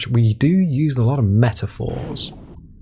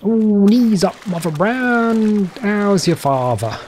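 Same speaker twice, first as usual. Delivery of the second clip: high, drawn-out mock English accent; slow sing-song English accent, starting high; 8 semitones higher, slower than usual. Shown as a demonstration. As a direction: ham up a jolly old London English accent. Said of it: "I think my English accent's pretty good".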